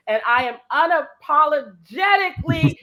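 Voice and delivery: loud, high and drawn-out proclamation; slow, booming "Yes, you can"; rousing shout. Real, not acted. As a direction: belt it out like a rallying cry. Proclaiming her motto to the audience.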